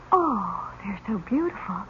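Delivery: hushed awe. Acted. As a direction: marvel at the dresses quietly. Girl over dead niece's clothes.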